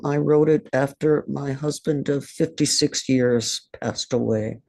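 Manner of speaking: normal talking voice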